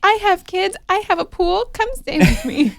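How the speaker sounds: high, piled-up pleas of a mock superfan; hammed-up high-pitched desperation; [name]. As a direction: imitate a fan begging him to visit. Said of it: "And it's like".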